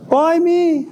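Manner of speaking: high, wailing why me; anguished outcry. Acted. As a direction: cry it out to the sky in outrage. Angry stage, outburst at fate.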